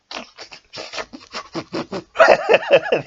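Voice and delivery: long high embarrassed laugh; real high cackle that trails off. Real, not acted. Laughing at own emotion.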